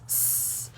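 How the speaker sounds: s, a long unvoiced hiss; letter sound s. Shown as a demonstration. Teacher demonstrating the s sound as a hiss. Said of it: "S says s".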